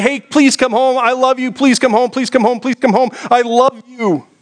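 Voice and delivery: high-pitched, repeated begging; pleading husband. Acted. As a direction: plead with your wife to come home. Husband begging wife to return.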